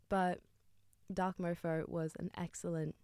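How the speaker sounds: ordinary talk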